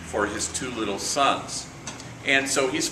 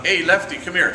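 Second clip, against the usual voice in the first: louder, higher call across the yard; 5 semitones higher, 5 dB louder than usual. Retold or imitated. Priest calling a homie over, loud call. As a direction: call him over, raised voice.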